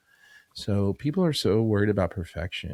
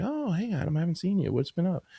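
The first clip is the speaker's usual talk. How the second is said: put-on higher, bright recognition of an old client; mock excited greeting; 10 semitones higher than usual. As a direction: greet him like a long-lost friend.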